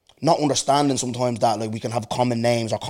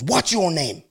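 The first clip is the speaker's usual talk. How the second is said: higher-pitched mock snappy question; retold snapping; 6 semitones higher, much slower than usual. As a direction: imitate an impatient caller demanding a name. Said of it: "he was snapping".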